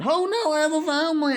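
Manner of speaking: high, fast mock pleading; rushed, high-pitched retold panic; mock begging. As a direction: plead for your life, hammed-up. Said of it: "he started begging for his life".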